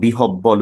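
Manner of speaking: বিহব্বল with doubled b, bi-hob-bol; hard double b after the h; mistaken pronunciation. Shown as a demonstration. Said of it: "উচ্চারণ করি".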